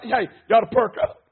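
ordinary talk, nothing marked

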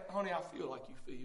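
put-on mother comforting her crying child, tenderly; soothing mama. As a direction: hush a hurt child like a mama. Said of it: "some healing in Mama's voice".

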